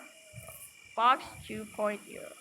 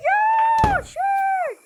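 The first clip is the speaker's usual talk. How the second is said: sudden high yelp of alarm, much louder; startled shout; 10 dB louder than usual. Real, not acted. Gamer jump-scared by a monster, high startled yell. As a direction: jolt and cry out.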